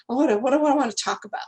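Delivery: quick, flustered self-questioning; boggled. Acted. Caller with three minutes on long distance, boggled and rushed. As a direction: rattle it off as if your mind is scrambling. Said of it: "your mind gets all boggled up".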